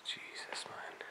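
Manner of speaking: whispered under his breath. Real, not acted. Explorer at the guarded gates whispering to camera, nervous.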